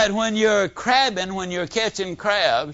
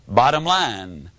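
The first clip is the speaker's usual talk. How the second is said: normal talking voice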